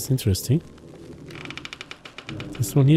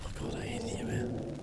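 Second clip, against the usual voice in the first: scared whisper; 18 dB quieter than usual. Real, not acted.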